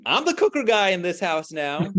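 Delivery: high, loud playful proclamation; loud declaration. Retold or imitated. Father borrowing his son's line as a motto, loud mock declaration. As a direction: crow it like a son announcing himself to the house. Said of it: "he declares loudly to the house".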